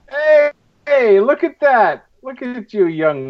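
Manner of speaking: put-on high, loud cackly old-timer voice; crotchety old coot calling out; old prospector. Teasing about old photos.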